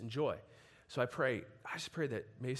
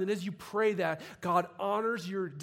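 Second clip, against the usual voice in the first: building, louder and higher sermon climax; preaching at full force; 5 semitones higher, 5 dB louder than usual. Acted. Urging the congregation to live for the kingdom, preaching full force. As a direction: drive it home, rising to a shout.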